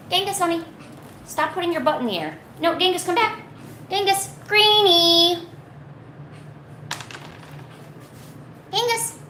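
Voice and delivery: high sing-song pet calling; talking to a dog. Real, not acted. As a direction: call a pet back sweetly.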